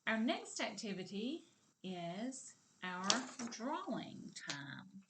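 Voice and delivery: usual speaking voice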